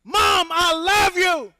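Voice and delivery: high, loud calling out; shouting out window. Acted. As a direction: yell for mom from window. Recruit shouting to mother.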